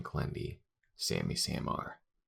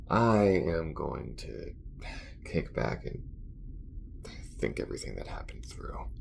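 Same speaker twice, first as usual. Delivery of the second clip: grudging compliment, then tired venting; halting, worn-out talk to a partner; fed up but easing into banter; weary space crewmate; 2 semitones lower than usual. Acted. Acted character talks with crewmate.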